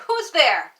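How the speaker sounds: a sudden loud startled cry; old man crying out. Acted. Old man startled awake in the dark, crying out loud. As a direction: shout it out, woken in fright. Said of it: "crying out".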